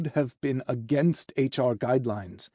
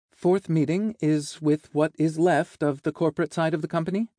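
higher, careful assistant voice, lifting at the end; secretary briefing; 5 semitones higher, 3 dB louder than usual. Acted. Secretary naming the fourth meeting to the boss, high and careful. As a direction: announce it brightly but tentatively.

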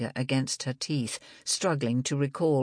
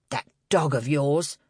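glaring reproach. A narrator's reading. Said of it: "to glare at Libby".

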